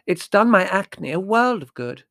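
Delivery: high, loud indignation; emphatic youthful protest; angry. A narrator's reading. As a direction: defend it hotly. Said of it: "said Margot angrily".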